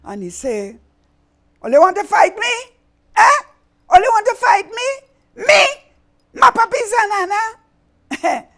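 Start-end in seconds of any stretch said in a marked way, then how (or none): 1.6-3.4 high, loud outraged challenge; angry villain. Acted. Villain enraged. 3.9-8.5 even higher, louder outraged roar; furious villain. Acted. Villain enraged.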